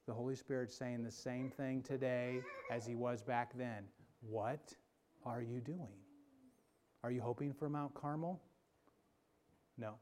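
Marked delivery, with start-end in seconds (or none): 4.2-5.9 soft, low questioning; hushed still small voice; quiet voice. Acted. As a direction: ask it gently and low. Quiet voice of God.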